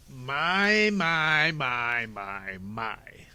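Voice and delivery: a gloating villain sneaking up behind them; sly villain. Acted. Stefano creeping up on the orphans, gloating loudly.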